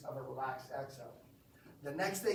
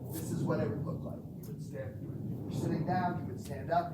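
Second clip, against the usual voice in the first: ordinary delivery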